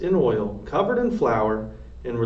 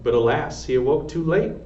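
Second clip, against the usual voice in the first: plain reading voice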